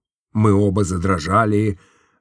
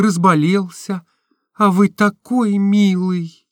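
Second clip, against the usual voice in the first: put-on higher, lighter girlish voice for Nastenka; Nastenka's voice; 8 semitones higher than usual. A narrator's reading. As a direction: voice the young woman, tender and open. (голос Настеньки, выше и легче)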